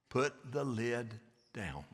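slow, quiet, word by word; low, deliberate instruction; slow dictation. Shown as a demonstration. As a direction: space out each word for repeating. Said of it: "Say that with me".

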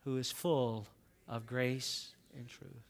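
soft, slow congregational reading; hushed scripture recitation; reading scripture aloud. Real, not acted. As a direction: read it together, reverently. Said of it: "Read the rest of this with me".

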